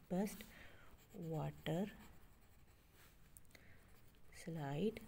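Best, water, slide apart with long pauses; slow counting-out of best water slide; word by word. Shown as a demonstration. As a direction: dictate best... water... slide, each rising. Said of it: "इसके five syllables इधर आगे".

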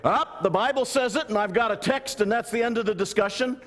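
high, insistent mock certainty; dogmatic cutoff. Preacher imitating someone ending the discussion, mock dogmatic. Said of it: "If you're gonna say".

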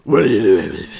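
low, loud, gritty caped hero growl; Batman voice. Pretend. Asked for a Batman voice, growls at the bat. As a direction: growl it like a brooding vigilante. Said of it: "Can you do the Batman voice?".

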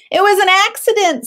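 put-on high, sing-song child voice claiming innocence; a little boy insisting it wasn't him; innocent fib. A narrator's reading. Harry to the teacher, a high fake-innocent child voice.

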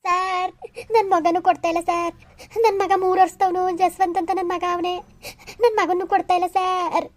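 crying plea, words drawn out at the ends; tearful wailing. Acted. Mother begs police officer for her little son, crying. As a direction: plead through sobs, wailing between phrases. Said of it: "ಅಳ್ಬೇಡಿ".

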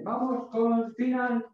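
ordinary delivery

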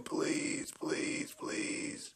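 breathless whisper, begging; real whispered please; whispered pleading. Real, not acted. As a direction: whisper it like a wish. Praying for a rare summon.

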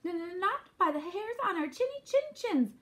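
high, stammering scared piglet; frightened but defiant little pig; trembling. A narrator's reading. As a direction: stutter the first word, then squeak out the refusal. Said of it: "trembled Boris".

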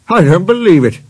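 loud, high-pitched disbelief; flat refusal to believe. A narrator's reading. Engine rejects tale of mountain climber, disbelieving.

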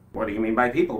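ordinary delivery

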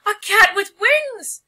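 very high, loud slow shriek. Acted. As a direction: shriek it in terror. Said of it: "shrieked the town mouse".

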